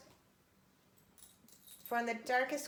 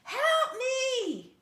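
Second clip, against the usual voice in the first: a huge shrieking roar, much higher and louder; 11 dB louder than usual. A narrator's reading. Giantess begging a stranger for help: a huge roared plea. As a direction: bellow it out like a desperate giantess. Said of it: "a huge woman roared".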